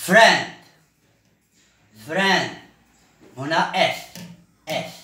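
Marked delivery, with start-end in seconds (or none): 0.1-2.5 louder, called out; loud drill call. Shown as a demonstration. Teacher calls out the new word to the class, loud. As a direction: announce the word to the whole class.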